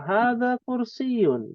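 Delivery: put-on mother teaching child. Mother pointing at a chair for her child, slow and clear. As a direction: say it slowly so the child learns. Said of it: "ummahat bisa bilang".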